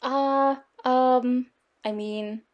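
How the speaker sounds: drawn-out stalling, trailing off; flustered hesitation. A narrator's reading. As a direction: stall awkwardly for an answer. Father caught out, stalling.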